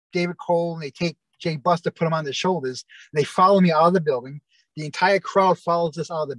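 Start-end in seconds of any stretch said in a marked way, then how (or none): none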